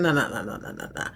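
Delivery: low, fading run of mock no-no-nos; dismissive brush-off. Shakedown men brush off Gary's protest.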